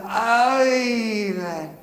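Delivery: high, slow put-on Scottish lilt; imitating Scottish caller. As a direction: gush like a fond old Scottish woman. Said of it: "And she said".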